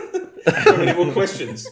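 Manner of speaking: loud, high, helpless laughter through the question; laughing. Real, not acted. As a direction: try to ask while cracking up. Cracks up while asking.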